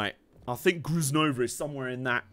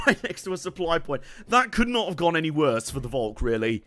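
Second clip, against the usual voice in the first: ordinary talk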